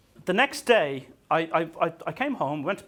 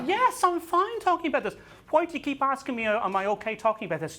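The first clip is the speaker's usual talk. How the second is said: exasperated high protest; 10 semitones higher than usual. Retold or imitated. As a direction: snap back at the fussing interviewer. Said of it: "I was like".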